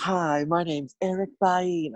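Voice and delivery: slow, bright beauty queen introduction; poised pageant answer. Acted. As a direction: introduce yourself like a pageant contestant on stage. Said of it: "Give us that pageant answer".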